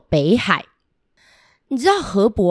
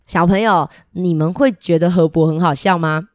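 ordinary delivery, nothing marked

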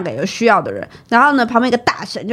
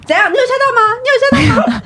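taunting challenge; 14 semitones higher, 3 dB louder, faster than usual. Retold or imitated. Friend taunting the child.

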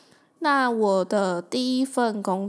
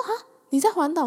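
surprised; 8 semitones higher, 4 dB louder, faster than usual. Retold or imitated. Recruiter surprised at road trip.

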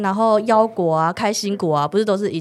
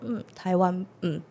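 quieter, unsure and sheepish; a guilty half-hearted answer; 8 dB quieter than usual. Retold or imitated. To the customer, sheepishly quiet and guilty. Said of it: "就是有点心虚".

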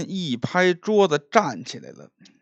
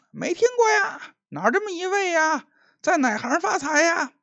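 much higher, lively voice of the curious crowd; curious onlookers. Acted. Tavern patrons asking Liu who the rich man is, in a high voice. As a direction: ask eagerly like nosy tavern patrons.

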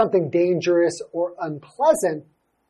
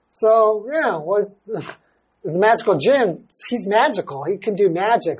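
ordinary delivery

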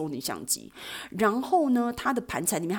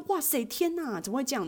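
shocked; 9 semitones higher than usual. Retold or imitated. Shocked reaction retold.

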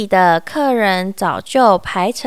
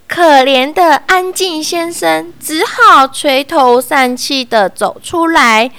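ordinary delivery

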